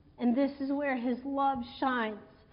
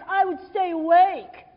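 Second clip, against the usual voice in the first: mock boast; 7 dB louder than usual. Mock boasting about staying awake.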